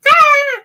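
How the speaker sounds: very high, loud short scream; sharp shriek. Acted. Medium acting out hysterical laughing with a high shriek. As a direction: let out a hysterical yelp. Sudden outburst about hysteria.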